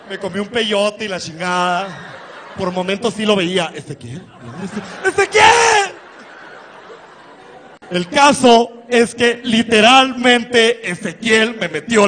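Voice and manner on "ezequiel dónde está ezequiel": laughing call. Retold or imitated. Searching while high on peyote.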